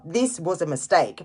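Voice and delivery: usual speaking voice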